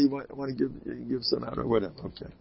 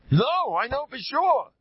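mock insistent scream; 13 semitones higher, 4 dB louder, slower than usual. Shown as a demonstration. Screams to make himself sure.

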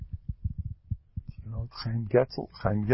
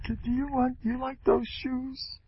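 much higher, softer coaxing mother; doting mother; 5 dB quieter than usual. Retold or imitated. Mother asking her little child about shoes, high coaxing. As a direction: coo at the child like a pushy-sweet mom. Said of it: "the mothers come big".

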